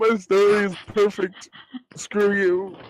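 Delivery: loud, high, mock offended; playfully huffy outburst; mock indignant. Defending story, mock indignant.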